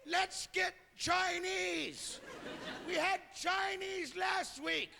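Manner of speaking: high, drawn-out hollering; bickering pair with identical high voices; couple sounding alike. Acted. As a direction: call out slowly on one high note. Acted couple who sound alike.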